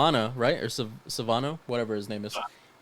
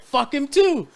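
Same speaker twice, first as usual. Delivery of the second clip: much higher, louder yell; cheering shout; 14 semitones higher, 8 dB louder, slower than usual. Retold or imitated. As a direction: cheer the kill like a fan. Cheering the dad's death.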